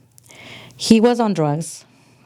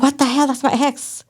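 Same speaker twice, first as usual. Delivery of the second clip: much higher, shocked disbelief; retold shock; 8 semitones higher than usual. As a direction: act out the double take. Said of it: "I was like".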